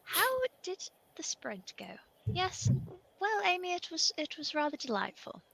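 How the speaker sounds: put-on a rather delightful old wizard, hushed; posh wizard from the D and D game. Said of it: "I'll just put my wizard voice on".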